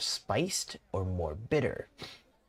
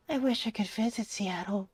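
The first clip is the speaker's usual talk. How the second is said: put-on young character voice; 12 semitones higher than usual. Young character wishing to visit Seattle, high put-on voice. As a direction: wish aloud in a small high voice. Voices Vin's wistful line.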